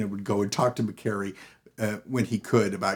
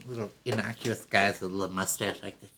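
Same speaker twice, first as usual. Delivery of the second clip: ordinary talk, nothing marked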